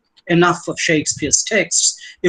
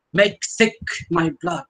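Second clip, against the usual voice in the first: slow, higher "Make thick", both words weighted; two consecutive stresses; 5 semitones higher than usual. Shown as a demonstration. Lecturer illustrating thickness of sound, "Make thick" heavily double-stressed. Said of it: "two consecutive syllables being stressed".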